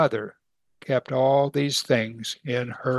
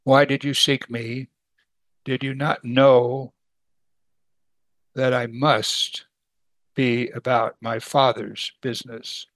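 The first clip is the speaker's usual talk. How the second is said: solemn scripture quote; 2 semitones lower, slower than usual. Acted. Solemn reading of Jesus' words.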